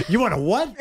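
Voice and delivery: high, laughing mock surprise. Pretend agent on a phone call, high, laughing mock surprise. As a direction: squeal it out, half laughing. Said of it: "I'd be like".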